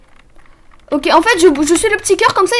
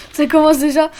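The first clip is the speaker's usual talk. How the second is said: ordinary delivery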